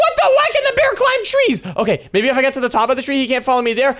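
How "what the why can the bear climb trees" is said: shocked. Acted. Bear climbs the tree.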